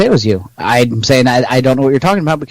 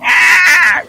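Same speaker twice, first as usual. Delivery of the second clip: pained cry; 4 dB louder than usual. Retold or imitated. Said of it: "he's like".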